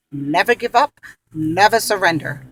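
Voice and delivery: big rousing battle cry; loud movie quote. Retold or imitated. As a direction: shout it like a film hero. Quoting her Galaxy Quest motto.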